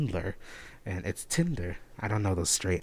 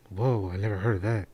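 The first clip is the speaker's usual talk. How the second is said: mock amazement; 6 dB louder than usual.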